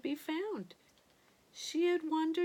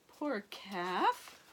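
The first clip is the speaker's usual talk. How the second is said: usual speaking voice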